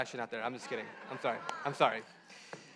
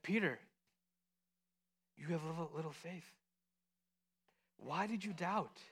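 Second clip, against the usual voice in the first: very soft, tender voice; hushed, patient reassurance; gentle reproof; 11 dB quieter than usual. Acted. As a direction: chide a friend gently, with pauses. Voicing Jesus gently reproving Peter.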